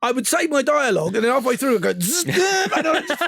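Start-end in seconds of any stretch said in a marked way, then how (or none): 1.9-2.7 high-pitched mock wheelchair whirr; imitated motor buzz. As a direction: buzz like a little motor jerking into motion.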